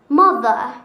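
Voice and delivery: Mother in a British accent, loud. Shown as a demonstration. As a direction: say Mother for the class to repeat. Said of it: "Say after me".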